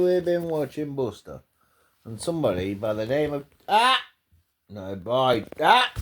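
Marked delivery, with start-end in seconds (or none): 3.7-4.0 sharp startled yelp; yelp of pain. Real, not acted. Owner yelps as parrot bites her finger. 5.6-5.9 sharp high yelp; yelp of pain. Real, not acted. Owner yelps at the biting parrot.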